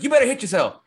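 high, loud frantic dad command; mock furious order. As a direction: order him like the raging dad, pitched high. Retold dad freaking out, ordering son.

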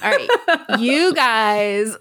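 high giggling through the words; laughing. Real, not acted. Host cracks up at her co-host, high laughing.

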